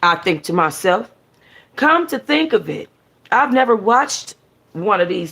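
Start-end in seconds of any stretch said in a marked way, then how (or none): none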